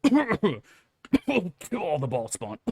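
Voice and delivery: high squeezed words between coughs; coughing voice. Acted. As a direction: croak it out mid-cough. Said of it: "Helm is having a coughing fit".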